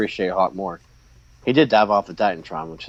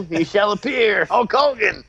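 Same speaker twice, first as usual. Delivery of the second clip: excited announcement; 9 semitones higher than usual. Real, not acted. Heralds Hulk Hogan's entrance.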